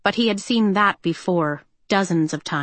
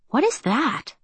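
surprised; 7 semitones higher than usual. A narrator's reading. Fatin spots a strange shadow.

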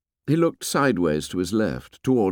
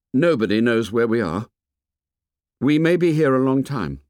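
higher, lightly voiced boy; halting start with raised pitch; boy leader faltering; 5 semitones higher than usual. A narrator's reading. Ralph searches for words before the meeting, halting and higher.

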